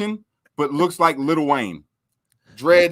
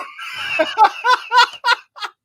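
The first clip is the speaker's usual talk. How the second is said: loud laugh; 4 dB louder than usual. Real, not acted. Cracking up at the prank.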